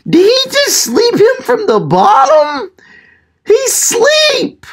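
high falsetto shock, astonished; stunned disbelief. Real, not acted. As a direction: shoot the pitch way up, amazed at the knockout. Repeats shocked reaction to knockout.

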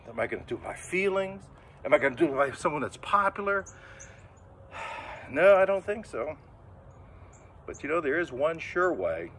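ordinary delivery

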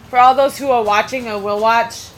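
ordinary delivery

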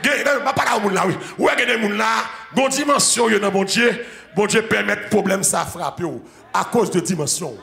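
loud, high, fervent sermon cry; preaching at full force. Acted. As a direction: preach it out to the whole church. Preaching to congregation. (prédication à pleine voix)

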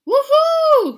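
very high, loud whoop; hooting. Acted. Crowd hooting, loud high whoop. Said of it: "hooted the crowd".

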